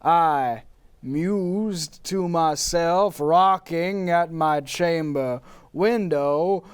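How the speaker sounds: slow, hushed poetic reading; dramatic poem recital. Acted. Poe's spirit reciting his poem to the host.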